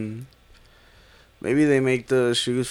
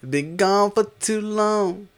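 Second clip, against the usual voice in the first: high, slow sing-song echo; 9 semitones higher, slower than usual. Acted. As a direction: stretch it out high. Echoed line, playful.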